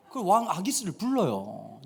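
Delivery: ordinary talk, nothing marked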